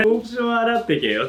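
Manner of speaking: high mock complaint, teasing; high teasing grumble; mock scolding friend. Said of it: "お前さ、つって".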